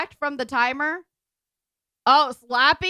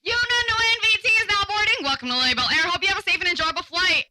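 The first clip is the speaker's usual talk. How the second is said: over the intercom; 5 semitones higher, faster than usual. Pretend. Airline boarding announcement.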